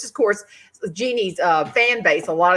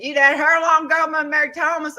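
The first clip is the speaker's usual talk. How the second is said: higher, louder drawled rural man's call; brash stockyard buyer sizing her up; cattle buyer; 8 semitones higher, 6 dB louder than usual. Retold or imitated. Cattle buyer to young wife at stockyard, loud rural voice.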